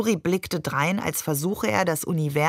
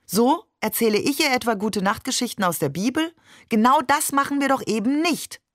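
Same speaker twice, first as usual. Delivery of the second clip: indignant retort; 7 semitones higher, faster than usual. Acted. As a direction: snap back at him, rising in indignation. Mother rebukes Barbe in argument.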